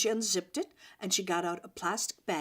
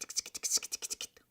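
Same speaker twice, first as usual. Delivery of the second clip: soft whispered skit sounds fading out; whispered skittering; 5 dB quieter than usual. A narrator's reading. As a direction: tiptoe the little feet sounds in a whisper. Gerbil feet sound effect.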